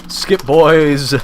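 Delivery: loud, high rallying call. Real, not acted. Urging skip of cutscene.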